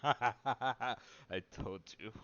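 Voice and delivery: laughing through the words, slow and loud; told-you-so laugh. Real, not acted. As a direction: crack up while saying it. Laughing at the character's blunt verdict.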